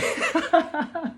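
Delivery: a sudden high-pitched burst of laughter. Real, not acted. Man laughing at his cancelled dental appointments, high-pitched laugh. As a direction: laugh high and breathy at the absurdity.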